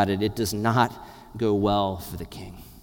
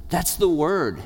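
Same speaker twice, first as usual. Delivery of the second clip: ordinary talk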